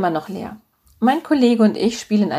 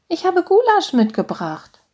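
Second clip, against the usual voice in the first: high motherly voice announcing a treat; doting mother; 11 semitones higher than usual. A narrator's reading. Mother has brought food for her son, high and doting.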